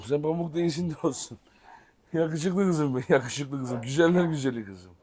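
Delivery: soft, tender pet talk; cooing to dog. Real, not acted. Owner cooing softly at his dog. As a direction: coo softly to the puppy.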